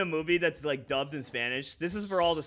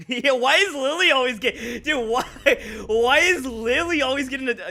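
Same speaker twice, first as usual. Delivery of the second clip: high, loud incredulous whine; loud disbelieving outcry; exasperated; 8 semitones higher, 10 dB louder than usual. Real, not acted. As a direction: protest it to the whole squad. Exasperated at teammate Lily's luck.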